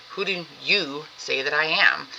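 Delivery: the question turned pointedly onto "you"; stress on YOU. Shown as a demonstration. Teacher asking us to account for belief, emphatic on "you". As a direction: linger on YOU, then let the rest follow. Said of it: "he's putting an emphasis on the question".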